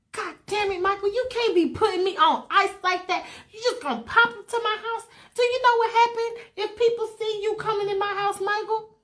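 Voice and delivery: high-pitched, fretful telling-off; hammed-up flustered reproach; exasperated scolding. [name] scolds [name] for popping into her house, exasperated.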